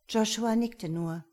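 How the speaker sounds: ordinary delivery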